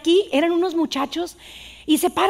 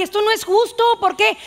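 indignant protest; 7 semitones higher, 3 dB louder than usual. Retold or imitated.

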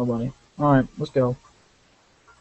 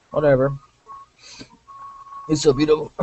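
normal talking voice

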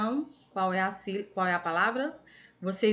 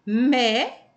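"mé" alone, loud and held; isolated stressed syllable; 3 semitones higher, 6 dB louder than usual. Shown as a demonstration. Teacher showing pupils the tonic syllable, "mé" said forcefully. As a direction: push out "mé" as the strong syllable. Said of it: "é a sílaba mais forte".

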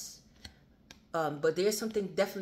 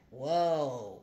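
mock admirer; 5 dB louder, slower than usual. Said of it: "they're gonna be like".

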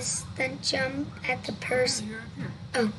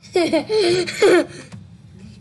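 loud, higher-pitched laugh; real laughter; 5 semitones higher, 10 dB louder than usual. Real, not acted. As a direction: crack up after the scare. Laughs after the jump scare.

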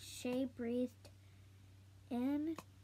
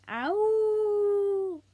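long high loud howl; dog howl; 9 semitones higher, 13 dB louder than usual. Acted. Noodle the poodle howling, loud and long. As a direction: throw back the head and howl. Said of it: "she howled".

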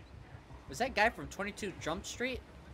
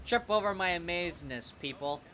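mock grandiose; 2 semitones lower than usual. Player boasting to game crowd, mock grandiose. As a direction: stretch it out like a pretend conqueror.

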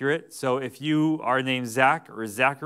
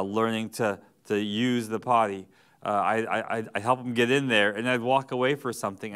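ordinary talk, nothing marked

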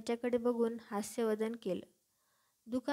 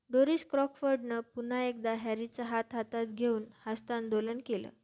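usual speaking voice

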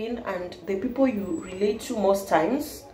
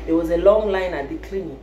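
ordinary delivery

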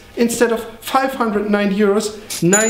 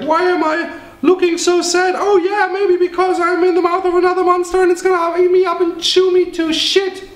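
much higher, fast, whiny creature; little monster voice; 12 semitones higher than usual. Acted. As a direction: squeak like a small doomed monster. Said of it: "a monster inside going like".

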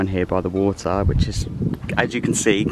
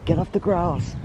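movie quote voice; 8 semitones higher than usual. Retold or imitated. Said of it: "thinking about the scene from Princess Diaries where they say".

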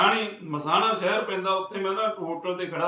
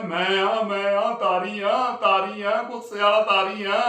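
loud retold shout through the gate; insistent loud call, repeating the name; calling out loudly; 2 semitones higher, 5 dB louder, slower than usual. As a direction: yell it's me so they open up.

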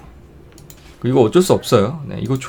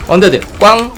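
panicking gamer; 7 semitones higher, 5 dB louder, faster than usual. Real, not acted. Panicking in a game fight.

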